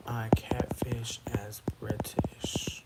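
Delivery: slow secretive whisper; slow conspiratorial whisper to the chat. Real, not acted. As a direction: breathe the question out slowly like a secret. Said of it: "let me ask my question".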